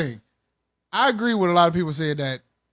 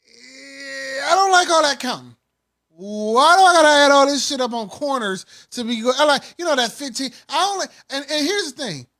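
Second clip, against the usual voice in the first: excited rant; 7 semitones higher, 5 dB louder than usual. Real, not acted. Raised-pitch rant on counting.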